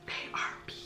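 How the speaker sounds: soft breathy whisper to camera. Real, not acted. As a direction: hush it like a secret. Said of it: "Why am I whispering?".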